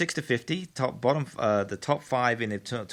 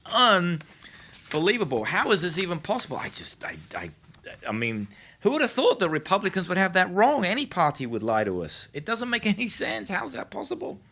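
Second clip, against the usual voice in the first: higher, hammed-up astonishment; sarcastic incredulity, pitched up; mock disbelief; 6 semitones higher than usual. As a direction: pretend your mind is blown.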